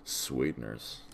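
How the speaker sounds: soft low murmur. Shown as a demonstration. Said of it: "let me whisper it for you".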